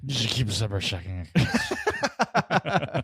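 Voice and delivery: holding court at the family table; embarrassing uncle. Pretend. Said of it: "I should be saying this in drunk uncle voice".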